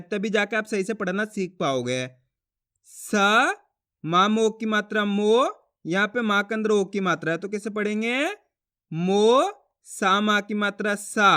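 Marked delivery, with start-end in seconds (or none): 2.9-5.5 सा held long, then मो stretched with a rising end; drawn-out teacher's syllables; read-along sounding out. Shown as a demonstration. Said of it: "आपको ही मेरे साथ साथ पढ़ना है". 8.9-11.3 मो held long and rising, then a slow stretched सा; drawn-out teaching syllables; letter-by-letter sounding out. Shown as a demonstration. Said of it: "एक ही अक्षर का उच्चारण करना है".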